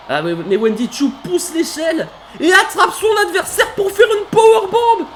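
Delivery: high, loud, laughing yell of disbelief; excited shout. Real, not acted. Commentator shocked by a ladder powerbomb, laughing shout. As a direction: scream with delight at the powerbomb. Wendy Choo counters, powerbomb.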